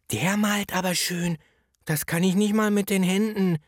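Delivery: put-on high-pitched kindergarten kid voice; admiring child. Kindergarten child watching the mouth painter, admiring high voice. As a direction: marvel like a small child, high up.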